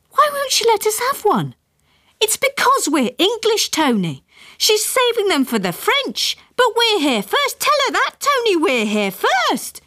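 high shrill indignant wife; indignant mother. A narrator's reading. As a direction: complain high and outraged.

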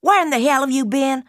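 high fast furious demand; shouting mother. A narrator's reading. Mother yells at her daughter for being late, high and fast. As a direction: hurl the question at her. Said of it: "She was cursing and shouting".

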